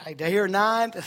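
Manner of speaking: playful auctioneer call. Pretend. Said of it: "I feel like an auctioneer".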